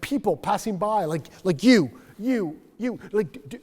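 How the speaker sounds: loud, high, stammering cry for someone to notice; frantic hello, can you see me; desperate plea. Retold or imitated. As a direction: call out to passersby, begging to be seen. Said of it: "so she's like".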